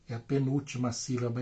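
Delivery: ordinary talk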